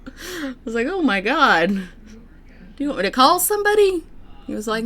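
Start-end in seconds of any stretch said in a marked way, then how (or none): 0.9-4.0 raised, louder worried voice; retold concern. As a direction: replay an alarmed offer of help. Acting out concern for injured man.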